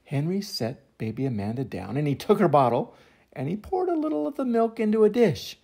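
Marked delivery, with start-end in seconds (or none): none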